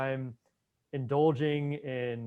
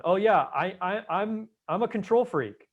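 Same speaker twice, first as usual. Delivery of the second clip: higher, louder mock confession; self-declared control freak; 7 semitones higher, 6 dB louder than usual. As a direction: play someone readily owning a label, pitched up. Acts out someone owning a label.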